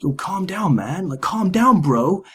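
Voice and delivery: high-pitched pleading bro voice; mock bro calming. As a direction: imitate the crew calming a mate down. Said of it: "all the other guys are like".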